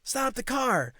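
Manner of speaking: high-pitched, urgent call to drivers; urgent roadside shout. Retold or imitated. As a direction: imitate a man frantically flagging cars. Acts out man flagging cars down.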